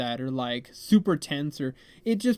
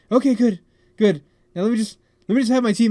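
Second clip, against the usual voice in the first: raised, higher, hurried insistence; impatient demand; 5 semitones higher, 8 dB louder, faster than usual. Retold or imitated. Stressed guest to friend's brother holding the hot water, loud impatience. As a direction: cut in loudly, eager for the tea.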